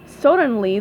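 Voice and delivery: usual speaking voice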